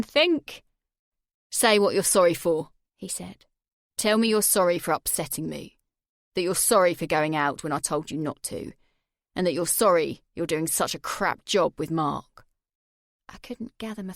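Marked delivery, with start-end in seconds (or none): 1.5-12.5 menacing Jay. Acted. Jay holding her face over the flame, menacing. Jay voiced through the scene.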